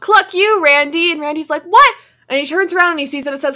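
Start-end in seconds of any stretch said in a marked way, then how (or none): 0.0-1.1 mock taunt. Retold gnome vandals taunting Randy. 1.6-1.9 high, loud startled yelp; startled what. Retold or imitated. Randy spinning round at the taunt, startled high yelp. Randy reacting in retold story.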